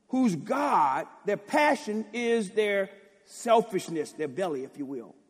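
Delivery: loud, high fervent preaching; preaching at full force. Acted. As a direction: thunder the verses at the congregation.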